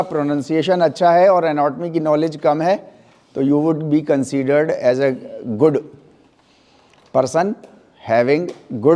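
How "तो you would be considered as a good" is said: ordinary delivery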